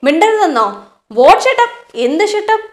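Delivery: loud, high, furious retort; angry roar. Retold or imitated. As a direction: shout him down in a rage. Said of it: "Ajit roars out". (ദേഷ്യത്തോടെ അലറൽ)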